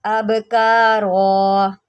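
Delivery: अबकारा opened with a sharp glottal catch, slow; clear hamza. Shown as a demonstration. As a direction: start अबकारा with a clean hamza. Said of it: "इसको आप अब नहीं अब पढ़ेंगे".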